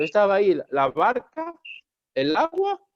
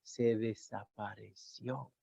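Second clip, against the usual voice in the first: very soft, low and slow; hushed wonder; 7 semitones lower, 15 dB quieter, much slower than usual. Acted. Preacher telling how the storm noise vanished, hushed. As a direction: let it fade away almost to nothing.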